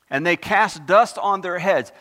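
loud, slow, solemn scripture reading. Acted. Preacher reads Revelation to the congregation, loud and slow. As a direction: proclaim the verse slowly to the congregation.

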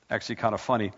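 ordinary talk

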